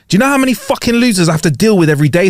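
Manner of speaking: indignant mock tirade; irate cabbie. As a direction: play a fed-up cab driver telling someone off. Rude cabbie rebuffs broke passenger.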